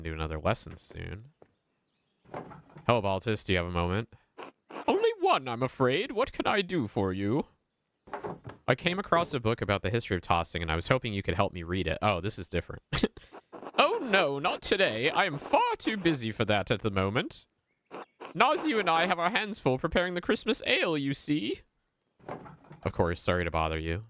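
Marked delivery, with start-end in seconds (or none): 4.9-7.5 put-on much higher, prim and polite old tradesman; a sharply higher, precise voice for a fussy craftsman. Voices Baltus character. 13.8-21.6 put-on much higher, prim and polite old tradesman; a sharply higher, precise voice for a fussy craftsman. Voices Baltus character.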